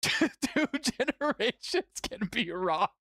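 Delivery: talking through real laughter; giggling out the words; laughing. Real, not acted. Laughing at pizza joke.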